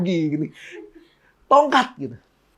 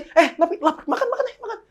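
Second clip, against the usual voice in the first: mock eager invitation; 10 semitones higher than usual. Mock friend inviting to eat.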